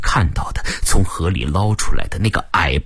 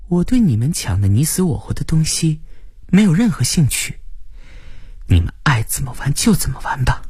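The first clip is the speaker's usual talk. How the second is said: higher-pitched, detached dismissal; cold indifference; 6 semitones higher than usual. A narrator's reading. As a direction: dismiss them coolly. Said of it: "齐家冷冷道". (冷冷地不屑道)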